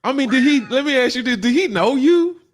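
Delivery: high, loud incredulous asking; can't believe what he heard; host pressing the guest in disbelief, high and loud; disbelieving question. Real, not acted.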